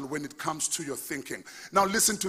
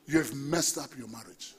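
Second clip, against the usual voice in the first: ordinary talk, nothing marked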